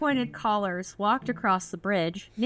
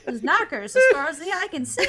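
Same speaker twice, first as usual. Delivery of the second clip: laughing joke, higher and louder; 8 semitones higher, 5 dB louder than usual. Real, not acted. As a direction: say it cracking up. Joking through laughter.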